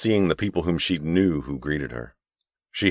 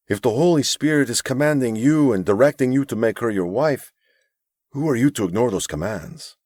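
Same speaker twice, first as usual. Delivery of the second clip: lightly voiced gentle older mentor; a hint of a kind, pleading elder; earnest mentor; 6 semitones higher than usual. Narrator voices Abram.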